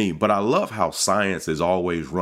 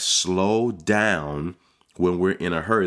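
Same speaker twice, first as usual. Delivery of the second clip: ordinary talk, nothing marked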